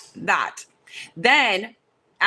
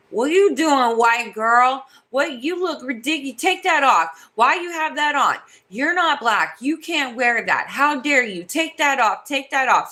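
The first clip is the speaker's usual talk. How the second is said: higher-pitched mock scolding voice; mocking the rude girl; 5 semitones higher than usual. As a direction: imitate her telling you off, pitched up. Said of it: "And she was like".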